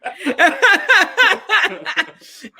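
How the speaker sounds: high burst of loud laughter. Real, not acted. Cracking up at her date's Obama line. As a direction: break into a big laugh.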